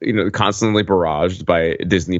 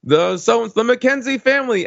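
imitated PA announcer; 11 semitones higher than usual. Crew announcing arriving guests over the PA, imitated announcer voice. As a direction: herald the family's arrival in a raised, held pitch. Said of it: "they announce it over the P A".

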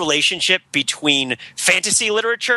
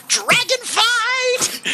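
much higher, slow drawn-out wail; slow high metal wail; much slower than usual. Acted. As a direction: stretch it out like a metal chorus. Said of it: "heavy metal voice".

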